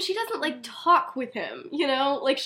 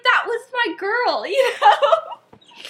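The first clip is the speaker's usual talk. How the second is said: high, slow mock wail through laughter; giggling mock heartbreak; laughing lament; 10 semitones higher, 7 dB louder, much slower than usual. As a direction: ham up a jilted lover's whine, cracking up. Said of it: "He's like".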